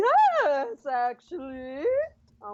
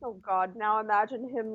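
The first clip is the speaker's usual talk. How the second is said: ordinary talk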